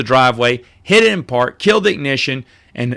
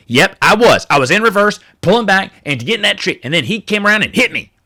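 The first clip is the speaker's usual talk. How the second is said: higher mock voice of an indignant driver; mocking the lady; 6 semitones higher than usual. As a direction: ham up the woman threatening to sue. Mimicking the lady admitting fault.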